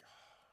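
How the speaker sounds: whispered breath of relief; soft exhaled "ah", acted; imitated sigh. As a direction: let out a hushed sigh. Imitates the man's reaction.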